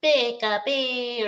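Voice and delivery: high, slow little creature squeak; Pikachu voice. Pretend. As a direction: squeak it like a tiny mascot.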